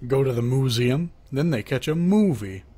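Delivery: normal talking voice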